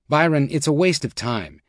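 usual speaking voice

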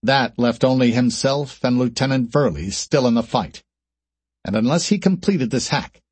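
plain reading voice

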